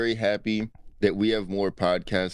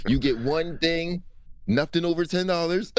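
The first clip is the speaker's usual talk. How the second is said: mock stingy comic; 9 semitones higher than usual. Mocking cheap comic's offer.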